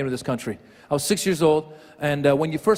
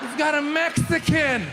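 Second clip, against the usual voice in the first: friends calling out; 9 semitones higher, slower than usual. Retold or imitated. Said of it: "the Persian girls, are like".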